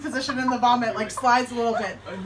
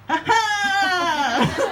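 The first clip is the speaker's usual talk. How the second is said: higher, louder triumphant "ha ha"; defiant laugh; 9 semitones higher, 7 dB louder than usual. Retold or imitated. Foreigner embracing being different, triumphant laugh. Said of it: "a very monumental moment of being like".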